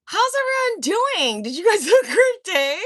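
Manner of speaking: sing-song fake welcome to pests; mock sweet greeting. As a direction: greet the flies like a cheery host.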